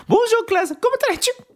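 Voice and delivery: very high, squeaky mock-French teacher; hammed-up French teacher imitation; imitating a French teacher. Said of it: "[name]'s imitation of it".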